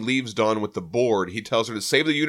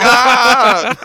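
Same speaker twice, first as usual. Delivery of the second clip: high, loud crowd scream; hammed-up shriek of terror; panicked scream; 13 semitones higher, 11 dB louder than usual. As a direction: scream as if fleeing for your life. Crowd panics at the Surfer's threat.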